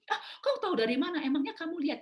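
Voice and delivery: high, quick indignant comeback; retold defensive retort. Husband denies breaking wife's perfume, defensive. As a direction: imitate a husband protesting an accusation.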